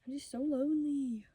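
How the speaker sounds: ordinary delivery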